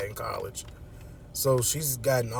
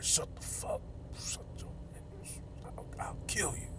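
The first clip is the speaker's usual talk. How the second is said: whispered threat, much softer; harsh whisper; 9 semitones higher, 14 dB quieter than usual. Retold or imitated. Killer silencing his captive in a whispered threat. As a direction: hiss the threat under your breath.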